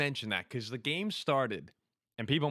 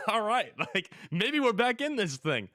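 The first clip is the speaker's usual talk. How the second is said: laughing hope; 9 semitones higher than usual. Retold or imitated. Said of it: "I'm like".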